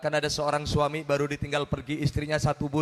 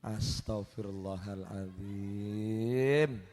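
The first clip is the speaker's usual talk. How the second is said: low, slow, drawn-out astonished sigh; mock shock; 11 semitones lower, 7 dB quieter, much slower than usual. Real, not acted. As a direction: groan it out in disbelief. Shocked reaction to punchline. (kaget dengan suara rendah dan pelan)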